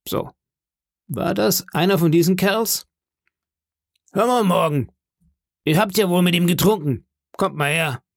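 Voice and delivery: high-pitched summoning voice; Silver, commanding landlord. Acted. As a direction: question sharply and summon the sailor over.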